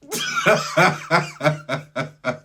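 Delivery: high, helpless laughter; real laughing fit. Real, not acted. Storyteller unable to continue his story, helpless laughing. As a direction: crack up completely. Cracks up again mid-story.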